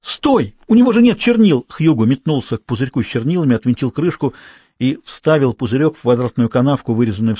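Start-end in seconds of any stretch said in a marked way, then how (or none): 0.0-1.7 high, alarmed call to stop; urgent warning. A narrator's reading. Boy stops the girl winding the toy, urgent. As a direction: cry out to stop it, pitched well up.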